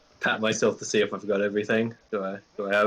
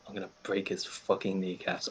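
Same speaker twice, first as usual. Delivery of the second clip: muttering; 9 dB quieter than usual. Acted. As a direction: grumble it quietly to yourself. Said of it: "I mutter to myself".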